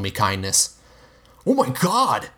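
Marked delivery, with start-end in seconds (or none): 1.5-2.3 a much higher, startled oh my God; shocked shout. A narrator's reading. Rob shouting in shock on the river shore. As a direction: cry out in shock. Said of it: "I heard Rob shout".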